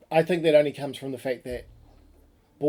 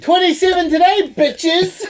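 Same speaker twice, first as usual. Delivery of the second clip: loud, high victory holler; mock celebratory yell; 10 dB louder, slower than usual. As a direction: yell the score out to the whole town. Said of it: "You're just yelling at it".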